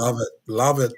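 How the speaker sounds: low, raspy, husky voice; deep raspy delivery. Real, not acted. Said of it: "that sexy, raspy voice you got going on".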